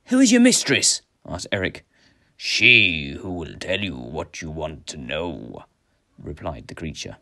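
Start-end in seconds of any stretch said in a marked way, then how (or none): 0.1-1.0 high, loud challenge; hero's raised-voice demand; demanding question. A narrator's reading. Hero challenges the strange creature. 2.4-5.6 low, slow, mysterious creature; drawn-out ominous riddle; eerie creature voice. A narrator's reading. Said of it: "like a thousand voices speaking together".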